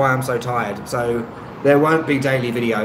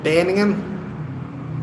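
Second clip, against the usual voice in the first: attempted Birmingham accent; 5 semitones higher, 3 dB quieter, much slower than usual. Shown as a demonstration. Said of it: "No, that's Li-, that's Liverpool as well".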